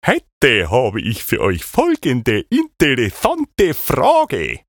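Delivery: high-pitched host voice. Acted. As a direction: play a chirpy high Siggi. Siggi's high video host voice. (hohe fröhliche Moderatorenstimme)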